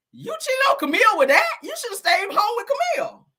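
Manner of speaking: high, loud scolding disbelief; shocked high shout. Retold or imitated. To Bill about cheating on Camille, a shocked high yell.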